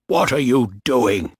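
indignant demand, mildly angry. A narrator's reading. Captain Bligh to the men holding him, angry. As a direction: demand an answer, a touch outraged. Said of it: "Bligh said angrily".

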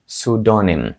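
pseudonym said as "soo-DON-im"; silent p. Shown as a demonstration. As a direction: start pseudonym straight on s. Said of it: "P на початку взагалі не вимовляється".

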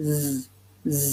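Z as a sustained voiced buzz; sound from throat. Shown as a demonstration. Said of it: "เค้นเสียงออกมาจากคอนะคะ".

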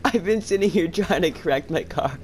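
high-pitched, laughing complaint; exasperated laughter. Real, not acted. Player trying to straighten the car, laughing exasperation. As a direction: protest high, on the edge of laughter.

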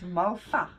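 "malva" with the v softened to an f; correct pronunciation of malva. Shown as a demonstration. Teaching the other how to say the pudding's name, "malva" said MAL-fa. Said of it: "that's the correct pronunciation".

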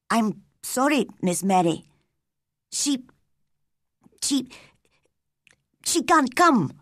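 frightened servant, halting and apologetic; timid, with long faltering pauses; afraid. A narrator's reading. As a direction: stammer out the bad news nervously. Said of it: "The woman looked afraid".

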